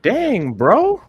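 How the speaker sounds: mock dismay. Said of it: "I'm like".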